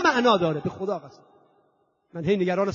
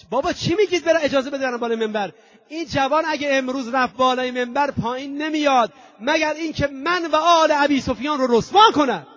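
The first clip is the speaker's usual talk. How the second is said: much higher, louder furious outburst as the caliph; angry tyrant's shout, climbing; Yazid shouting; 6 semitones higher, 5 dB louder than usual. Acted. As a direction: rage at the pleading crowd. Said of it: "یزید فریاد زد".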